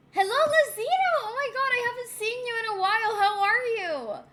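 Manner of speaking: high, fast, loud delight; excited greeting. Real, not acted. Greets long-missed chatter.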